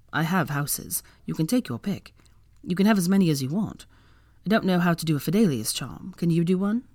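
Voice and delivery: usual speaking voice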